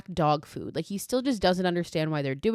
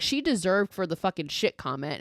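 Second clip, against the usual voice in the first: ordinary talk, nothing marked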